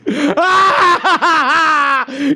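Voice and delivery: stuttering outburst of laughter; disbelieving cackle. Real, not acted. Streamer stunned by missed day, cackling outburst. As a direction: break into a pulsing cackle.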